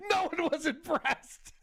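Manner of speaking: slow words through a laugh. Real, not acted. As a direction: giggle through it. Laughing at the flop.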